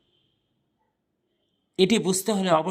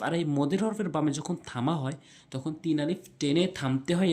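ordinary talk, nothing marked

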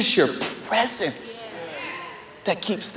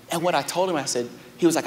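ordinary talk, nothing marked

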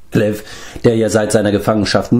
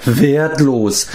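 slightly raised, sighed-out word; sighing; 5 semitones higher than usual. A narrator's reading. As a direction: say it with a resigned sigh. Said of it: "seufzte Glev".

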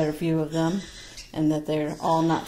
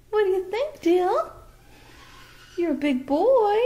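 much higher cooing praise to a bird; high pet praise; 12 semitones higher, 3 dB louder than usual. Real, not acted. Owner praising her parrot Dill, high cooing. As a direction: coo proudly up high.

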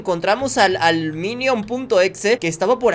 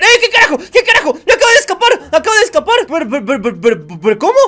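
high, loud astonished shouts with a laugh; laughing shock at the escape; shocked yelling; 7 semitones higher, 8 dB louder, faster than usual. Real, not acted. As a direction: cry out in amazed disbelief. Something escaped in front of him.

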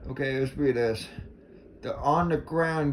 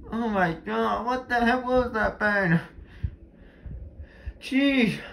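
shaken yelps; 9 semitones higher than usual. Real, not acted. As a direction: gasp it out, heart still racing. Still shaken after jumpscare.

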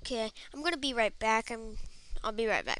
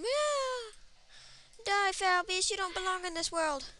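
mock battle cry; 7 semitones higher, 5 dB quieter than usual. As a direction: declaim it like a hammy little hero. Acted battle cry in game fight.

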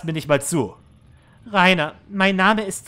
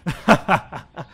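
mocking laugh. Manager mocks her wish.

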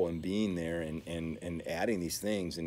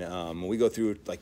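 ordinary delivery

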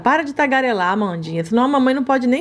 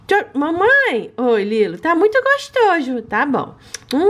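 ordinary talk, nothing marked

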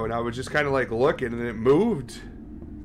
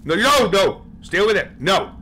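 high, loud, urgent refusals; alarmed, raised-voice warding off; panicked command; 7 semitones higher, 6 dB louder than usual. Real, not acted. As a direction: shout it to keep the threat back. Warding off a giant bug.

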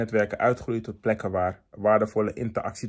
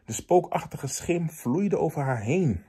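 slow, suspenseful story reading, stretching key words; dramatic horror narration; 4 semitones higher, slower than usual. Acted. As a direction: read it like a scary tale, rising to high-pitched drama. Reads a horror story aloud. (spannend griezelverhaal voorlezen)

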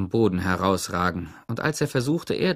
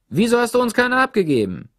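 much higher, loud indignant shout; narrated shout; 12 semitones higher, 6 dB louder than usual. A narrator's reading. Deo shouts at Stanley from his hole, loud and high. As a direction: call across, outraged. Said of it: "rief Deo".

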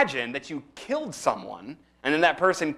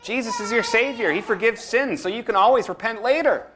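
higher, sweet-talking tempter voice; coaxing devil; 7 semitones higher than usual. Acted. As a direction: lure them like a sly tempter. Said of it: "he says".

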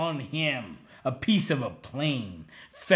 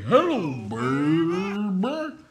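catchphrase impression; 4 semitones higher, much slower than usual. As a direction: belt the catchphrase with a swoop. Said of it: "loves his own phrase".

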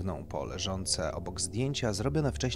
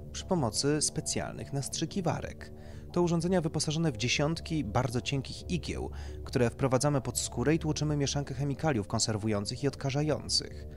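lightly voiced doctor, raised and emphatic; doctor explaining procedure; 7 semitones higher, 5 dB louder than usual. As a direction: explain it like a consultant to a client.

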